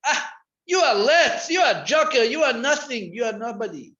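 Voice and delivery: high, loud scolding; mock scolding shout. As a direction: yell insults at the student. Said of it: "What are you screaming at me?".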